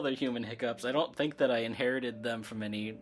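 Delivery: usual speaking voice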